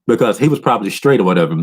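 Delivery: ordinary talk, nothing marked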